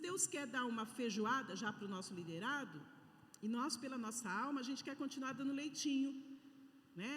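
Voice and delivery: normal talking voice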